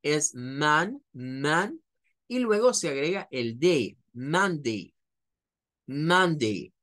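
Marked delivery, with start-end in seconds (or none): 0.3-1.8 "man" with a vowel between A and U; model pronunciation. Shown as a demonstration. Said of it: "entre una A y una U". 4.1-6.7 "Monday" as MAN-day; model pronunciation. Shown as a demonstration. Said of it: "Esa vendría siendo la pronunciación".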